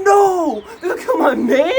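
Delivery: high, loud dramatic wail; anguished outcry. Retold or imitated. Viewer sees favourite hero speared, anguished outcry. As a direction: cry out in hammed-up horror. Re-enacts own horror at scene.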